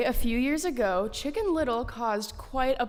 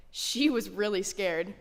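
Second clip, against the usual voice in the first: ordinary talk, nothing marked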